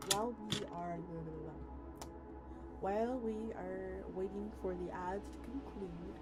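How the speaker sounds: usual speaking voice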